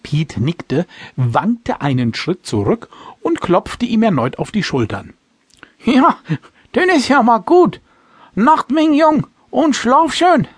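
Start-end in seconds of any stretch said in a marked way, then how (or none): 5.9-10.5 high, loud tipsy farewell; drunken slurring. Acted. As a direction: say goodnight like a merry old drunk. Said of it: "verabschiedete er sich".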